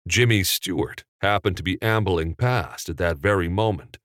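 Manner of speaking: usual speaking voice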